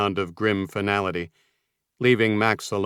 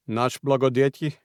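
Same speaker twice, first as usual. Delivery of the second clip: spoken in Russian; surprised question in Russian; 5 semitones higher than usual. A narrator's reading. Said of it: "Ivanov said in Russian".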